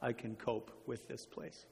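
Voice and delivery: quiet, low voice of despair; understated desperation, softly sinking; desperate. Acted. Corey confides despair to her sister in camp, quietly desperate. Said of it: "cried out to her sister in desperation".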